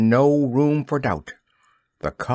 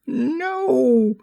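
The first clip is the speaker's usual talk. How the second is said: sharp fairy shriek, much higher; 14 semitones higher than usual. A narrator's reading. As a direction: shriek it to stop him drinking. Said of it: "shrieked Tinker Bell".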